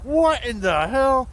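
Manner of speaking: high, loud, slow disbelief; shocked Muppet. Retold or imitated. Bert seeing the fish leap in, high slow astonishment. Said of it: "the real skinny one's like".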